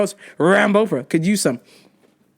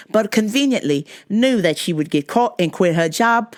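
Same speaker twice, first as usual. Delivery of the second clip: badly faked South African accent sliding into Australian; amateur South African newsreader accent; attempted South African accent; 3 semitones lower than usual. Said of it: "do a South African accent".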